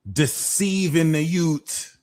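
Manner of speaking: high, drawn-out mock Jamaican accent; attempted Jamaican accent. Tarot reader pouring one out for the Agent Smiths, amateur Jamaican accent. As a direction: have a slow go at a Jamaican accent. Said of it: "I wish I mastered a Jamaican accent".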